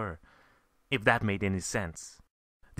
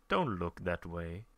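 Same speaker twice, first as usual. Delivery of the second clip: gentle; 5 semitones lower, 6 dB quieter than usual. A narrator's reading. Audience gentle.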